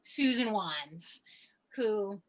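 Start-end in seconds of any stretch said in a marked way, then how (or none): none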